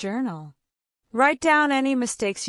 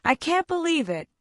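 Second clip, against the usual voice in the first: higher-pitched, mildly surprised example; demonstrated surprise; 7 semitones higher than usual. Shown as a demonstration. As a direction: let the voice go up to show surprise. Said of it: "notice how their voice goes up to show surprise".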